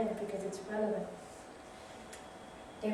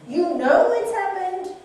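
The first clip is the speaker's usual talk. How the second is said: loud, high scolding; 6 semitones higher, 11 dB louder than usual. Acted. To people dwelling on the past, loud scolding. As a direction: push them to stop dwelling.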